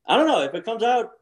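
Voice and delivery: high-pitched, mock-innocent protest; retold helpless excuse. Golfer excusing his blurting to his rival, mock helpless. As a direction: plead innocence in a lightly acted high voice.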